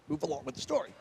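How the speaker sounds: hushed whisper, willing him to hurry; preacher recalling his silent thought while the friend rambles; whispered impatience. Retold or imitated. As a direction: whisper it under your breath, impatient.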